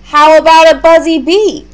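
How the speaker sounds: put-on boy Mason tempting his plant; coaxing kid. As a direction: offer the cricket like a treat.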